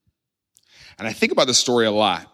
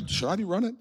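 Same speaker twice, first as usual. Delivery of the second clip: high, softer, amused question; puzzled onlooker; 7 semitones higher, 8 dB quieter, faster than usual. Acted. Someone sees a person run, puzzled and amused. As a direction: wonder aloud with a laugh in it. Imagined onlooker wondering at a runner.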